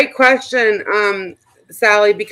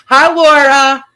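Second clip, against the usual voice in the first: taunting greeting; 5 semitones higher, 10 dB louder, slower than usual. Real, not acted. Taunting the watching streamer.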